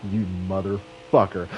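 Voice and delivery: low mock-angry curse; retold low, seething insult; imitating seething anger. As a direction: play a wrestler cursing under a simmering rage. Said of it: "The Rock gets so fucking mad".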